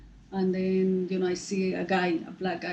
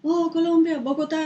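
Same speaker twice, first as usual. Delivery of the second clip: cheery recognition; 9 semitones higher, 8 dB louder, faster than usual. Retold or imitated. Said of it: "and then he, like".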